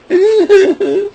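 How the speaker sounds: shrieking laugh; high laughter burst. Real, not acted. Mocking Solo Sikoa, a high shrieking laugh.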